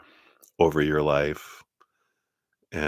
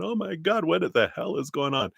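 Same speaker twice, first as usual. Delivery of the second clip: mock freaking out; 11 semitones higher than usual. Reader acting out a person in the freaking-out place, high mock panic. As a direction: play someone losing it, pitched way up. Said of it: "in that freaking out place".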